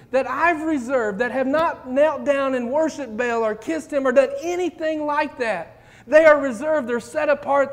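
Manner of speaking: high, loud, emphatic correction; God answering Elijah, loud and insistent; God correcting. Acted. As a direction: wag a finger, firmly setting him straight. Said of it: "God said".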